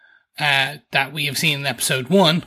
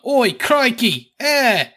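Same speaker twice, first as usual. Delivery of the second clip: high, slow cartoon Aussie exclamation; high-pitched mock Aussie cry; mock Australian accent; 8 semitones higher, 4 dB louder, slower than usual. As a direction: put on a hammed-up Australian accent with a Canadian tag. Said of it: "living in Canada and Australian".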